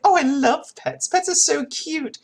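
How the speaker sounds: high-pitched mock gushing over pets; hammed-up cutesy enthusiasm; imitated gushing. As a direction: gush in a put-on high voice. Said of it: "And she's like".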